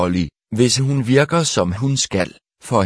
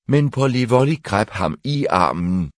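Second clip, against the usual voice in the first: usual speaking voice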